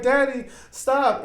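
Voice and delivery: slow, high mock-pleading girl; high, drawn-out begging imitation; crying daughter. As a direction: imitate a tearful little plea. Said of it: "while she's crying, she's like".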